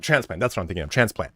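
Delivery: ordinary talk, nothing marked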